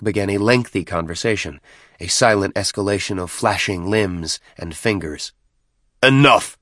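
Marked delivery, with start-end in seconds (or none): 6.0-6.6 loud, higher snap of command; snapped. A narrator's reading. Chieftain cutting off a lengthy conversation, snapped. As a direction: cut it off sharply. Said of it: "Maccabeus snapped".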